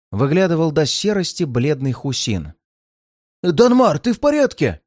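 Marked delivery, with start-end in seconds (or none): 3.4-4.8 frightened. A narrator's reading. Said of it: "испуганно кинулся ко мне палач".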